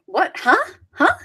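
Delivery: acted puzzlement, rising high; short questions, unable to make out a word; baffled. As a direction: play baffled and hard of hearing. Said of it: "I was like".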